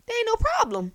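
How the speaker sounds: high and bright, "problem" falling to settle it; same delight but landing firmly down on "problem"; happy. Pretend. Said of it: "I would be so happy".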